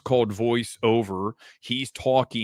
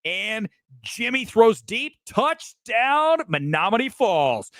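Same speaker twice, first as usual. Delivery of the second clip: much higher slow excited play call; sportscaster call; 9 semitones higher, slower than usual. Retold or imitated. Calling high school highlights live, excited sportscaster. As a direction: belt the touchdown like live highlights. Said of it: "I'd be like".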